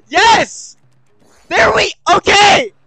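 real high, loud shout of triumph; excited shout. Real, not acted. Gamer thinking the stage is won, excited shouting. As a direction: yell out in celebration. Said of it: "I was excited there for a second".

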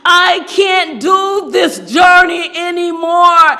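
high, slow cry of exhaustion; acted outcry. Teacup in the oven gives up to the master, acted outcry. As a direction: cry it out, drawing the words out. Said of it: "and I cried out".

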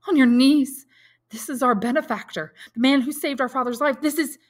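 high, loud, overwrought announcement; choked with emotion. A narrator's reading. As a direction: cry it out, overcome with emotion. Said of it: "in a voice choked with emotion".

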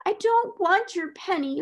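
high, slow, whiny sob of refusal; sobbing child. A narrator's reading. Crying child refuses a bribe, acted sobbing. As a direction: refuse tearfully in a little girl's high voice. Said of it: "sobbed Lottie".

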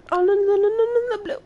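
high, loud rush of no's; gasp, then panicky rising protest; alarmed dismay. Real, not acted. Player crying out as things go wrong in the game, alarmed dismay.

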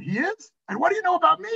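high, incredulous retold question; hammed-up surprised comeback; acted astonishment. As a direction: react in mock amazement, pitch jumping up. Retold astonished reply to barista.

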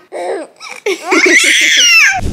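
high squealing laugh. Real, not acted. Laughing with baby.

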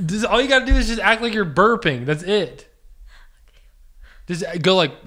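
ordinary talk

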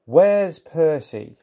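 slow, loud, high demand; storyteller's indignant question; cross demand. A narrator's reading. James storms out seeking Percy.

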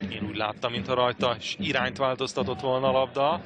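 normal talking voice

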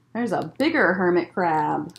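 usual speaking voice

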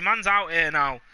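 ordinary delivery

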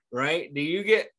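ordinary delivery

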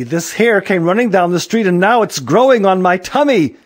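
high, loud, alarmed telling; upset complaint. A narrator's reading. Lady telling Aaron about hair on her, upset.

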